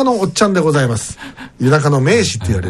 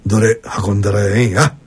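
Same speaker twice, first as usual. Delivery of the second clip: lower, put-on Kansai accent of a cheerful boss; fake Kansai dialect; 7 semitones lower than usual. Host voicing the president arriving at the shop, fake Kansai accent. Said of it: "こういうのが嫌ですよね".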